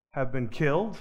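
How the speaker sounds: usual speaking voice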